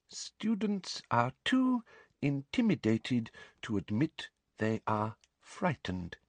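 slow, soft note-taking mutter. A narrator's reading. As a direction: mutter it word by word while writing. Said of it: "muttered Umbridge".

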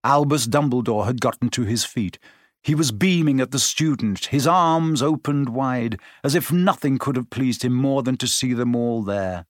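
ordinary delivery, nothing marked

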